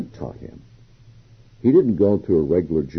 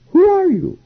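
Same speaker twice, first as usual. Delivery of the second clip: much higher, louder, disbelieving challenge; incredulous demand; 5 dB louder than usual. Retold or imitated. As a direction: fling the question back in disbelief.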